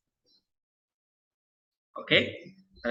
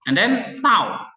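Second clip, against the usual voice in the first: high, slow, drawn-out announcement; mock-grand reveal of sigma; announcing next letter; 9 semitones higher, 6 dB louder, much slower than usual. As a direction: present the next letter like a show host. Teacher announces next Greek letter.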